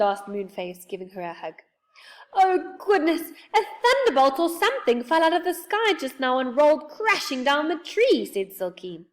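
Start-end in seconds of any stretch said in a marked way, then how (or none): 2.3-8.3 high, loud, flustered report; scared. A narrator's reading. Silky telling friends about the crash, high and alarmed. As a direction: gush out the fright at high pitch. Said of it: "looking scared out of her life".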